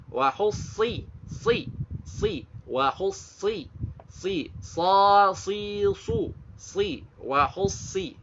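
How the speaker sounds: SI with a heavy, emphatic S, repeated slowly; sad sound drill. Shown as a demonstration. Teacher drills sad for learners, heavy S syllables. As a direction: drill WA HUSSI in pieces on a thick S. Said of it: "Suara sad".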